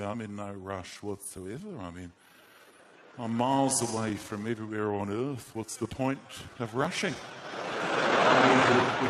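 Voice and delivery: laid-back Australian with no rush; relaxed, slow Aussie. Acted. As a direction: take your time, utterly unhurried. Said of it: "They speak so slowly".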